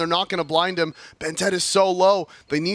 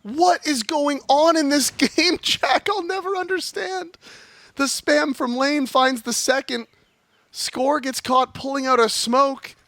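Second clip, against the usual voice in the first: amazed caster; 9 semitones higher than usual. Real, not acted.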